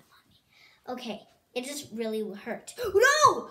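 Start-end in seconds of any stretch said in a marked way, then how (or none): 2.8-3.5 gasp. Real, not acted. Spinner almost falls.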